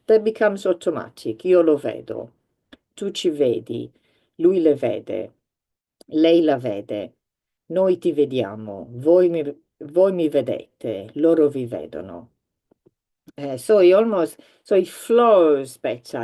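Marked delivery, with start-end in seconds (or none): 1.4-12.3 "Io lo vedo" and the other Italian lines recited as a steady drill. Shown as a demonstration. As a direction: run through the Italian phrases like a repetition drill. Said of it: "Say it enough times".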